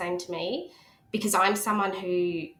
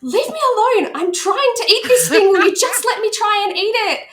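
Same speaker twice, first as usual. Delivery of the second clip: much higher, louder frustrated outburst; retold snap; 13 semitones higher, 9 dB louder than usual. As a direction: snap at your partner to back off. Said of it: "And I just snapped".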